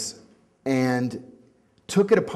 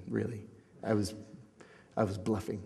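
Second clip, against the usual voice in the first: ordinary delivery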